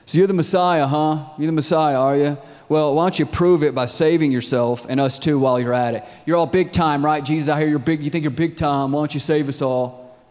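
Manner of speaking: loud, scoffing taunt; sarcastic heckling, pushed hard; mocking. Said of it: "mocked him, and said, you can imagine how he would say this".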